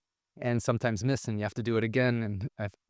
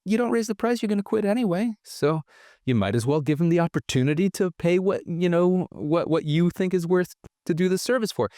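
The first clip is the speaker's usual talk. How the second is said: mimicked dad, much higher voice; wise dad; 8 semitones higher than usual. Dad telling the kids to raise the price, mock wise voice. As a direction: explain it patiently to the kids. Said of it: "And he says".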